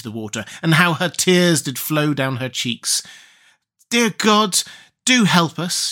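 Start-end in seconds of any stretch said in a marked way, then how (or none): 3.9-5.9 put-on crying girl. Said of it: "she cried".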